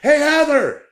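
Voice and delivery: high-pitched holler to someone out of sight; mock yelling. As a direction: imitate an oblivious guy calling out. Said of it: "he's just yelling like".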